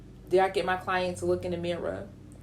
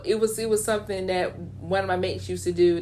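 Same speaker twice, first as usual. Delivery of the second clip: ordinary talk, nothing marked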